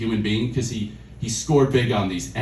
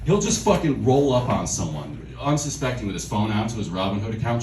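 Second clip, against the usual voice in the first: ordinary talk, nothing marked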